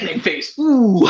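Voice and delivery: high, exaggerated warm-up exercise; vocal warm-up demo. Shown as a demonstration. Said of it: "warm-up things that they do".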